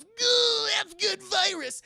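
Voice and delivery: loud, high, drawn-out exclamation; hammed-up yell as the virus goes in; mock straining cry. Acting her injecting the virus.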